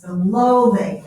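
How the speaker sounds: normal talking voice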